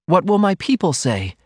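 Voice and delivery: high, worried heroine; sudden apprehension. A narrator's reading. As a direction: ask it with mild alarm. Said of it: "queried with sudden apprehension".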